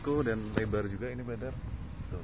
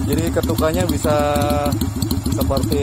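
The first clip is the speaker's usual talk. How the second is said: raised voice over engine noise; shouting over wind; 4 semitones higher, 9 dB louder than usual. Real, not acted. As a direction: speak up over the wind and motor. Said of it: "Anginnya kenceng banget".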